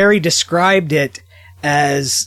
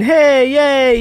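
mock cheer, pitched up and louder; sarcastic cheer; 10 semitones higher, 5 dB louder than usual. Mocking fan service.

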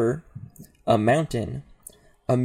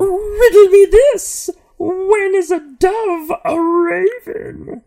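high, slow, sing-song villain cry; Riddler voice; 11 dB louder, slower than usual. Acted. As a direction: shriek the riddle like a gleeful supervillain. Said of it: "In a sing-song voice, the Sphinx mimics the Riddler's voice".

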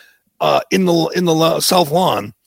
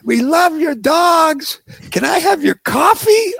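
much higher, slow, sugary put-on voice; mocking fawning reporter; 13 semitones higher, slower than usual. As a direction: ridicule the starstruck press in a high coo.

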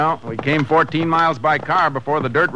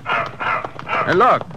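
excited call; 9 semitones higher than usual. Acted. Spots the dog, calls out.

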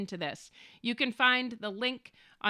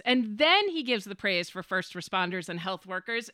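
normal talking voice

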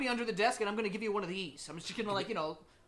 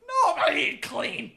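shout; 9 semitones higher, 12 dB louder than usual. Real, not acted. Laughing shouted denial.

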